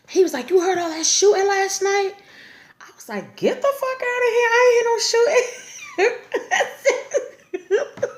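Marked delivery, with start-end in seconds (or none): 0.5-2.1 much higher, playful voice quoting him; boyfriend's teasing question. Retold or imitated. 3.3-8.1 much higher, slowed and laughing through the words; laughing disbelief. Retold or imitated. Her own disbelieving reply.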